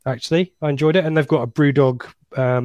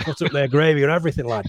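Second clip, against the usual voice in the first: quick, hammed-up northern English accent on "Not up there"; "lad" tossed off in a put-on northern English accent; mock northern English accent; 3 semitones higher, faster than usual. Joking with the podcast hosts about the stadium food, in a mock northern English accent. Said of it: "I don't know what accent that was".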